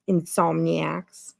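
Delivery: usual speaking voice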